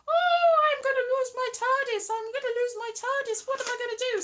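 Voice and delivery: high, mock-panicked wail; exaggerated high-pitched lament; David Tennant. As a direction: whine in hammed-up despair. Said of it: "David Tennant's like".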